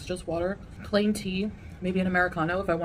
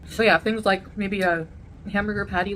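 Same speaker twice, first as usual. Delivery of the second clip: ordinary talk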